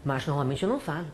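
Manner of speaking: ordinary delivery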